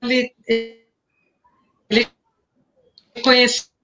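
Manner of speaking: choppy broken-up audio; words cutting out on a bad connection; buffering stutter. Real, not acted. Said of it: "Tá picotando".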